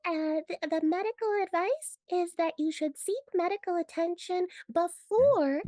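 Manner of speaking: high squeaky cartoon character voice. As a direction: chirp the advice like an old cartoon. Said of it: "you have to give the medical advice in a cartoon voice".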